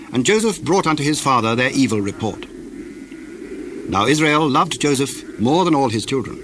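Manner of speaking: ordinary talk, nothing marked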